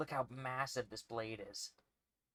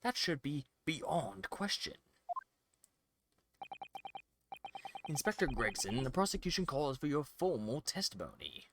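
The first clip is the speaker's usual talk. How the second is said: haughty prosecutor reading. Acted. Prosecutor scolds the defense in a haughty voice.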